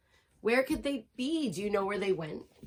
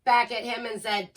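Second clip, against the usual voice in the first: plain reading voice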